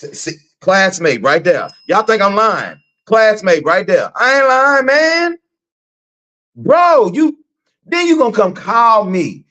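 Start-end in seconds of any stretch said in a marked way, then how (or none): 1.9-9.5 high, emphatic protest that he's not lying; excited insisting. Real, not acted. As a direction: plead to be believed, pitched up. Insisting to viewers, pitch up.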